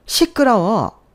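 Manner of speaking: sharp angry shout. Acted. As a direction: shut her up hard. 시어머니가 며느리에게 호통. (시어머니가 며느리 말을 자르며 소리침)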